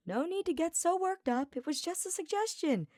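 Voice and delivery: a light, careless shrug in the voice; breezy Sylvain. Acted.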